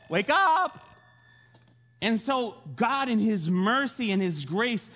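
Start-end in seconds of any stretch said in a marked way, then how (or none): 0.1-0.8 high, raised-voice call; urgent wake-up call. Retold or imitated. God tries once more to rouse Jonah, high insistent call.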